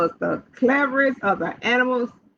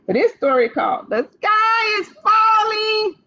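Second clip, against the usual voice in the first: excited announcement; 9 semitones higher than usual. Acted. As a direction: announce the title with a big burst. Teacher announcing story title.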